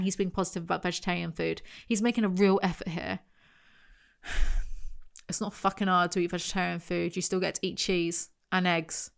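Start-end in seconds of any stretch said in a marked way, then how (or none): 4.2-5.0 heavy voiceless sigh; exasperated sigh. Real, not acted. Vlogger tired of Matt's fuss over food, exasperated sigh. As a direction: let out a fed-up breath.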